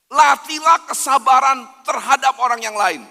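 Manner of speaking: ordinary delivery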